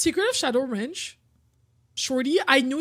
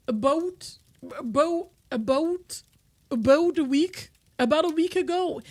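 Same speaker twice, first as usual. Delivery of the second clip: mock Canadian "about"; 3 semitones higher, 4 dB louder than usual. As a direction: exaggerate the Canadian "about a week ago". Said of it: "Is that what Canadians sound like to others?".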